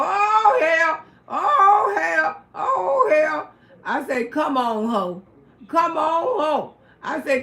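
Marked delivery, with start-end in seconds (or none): 0.0-3.5 high, loud wailing cries; alarmed crying out. Retold or imitated. Bar crowd crying out as she pops the bottle, loud alarmed wail. 4.2-6.7 louder taunting dare; fight challenge. Retold or imitated. Loretta daring the smoker to fight, a loud taunt.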